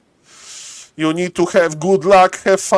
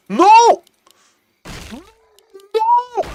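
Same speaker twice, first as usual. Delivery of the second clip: high, disbelieving cry; shocked no. Real, not acted. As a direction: cry out as the hero dies. Caster reacts to fight.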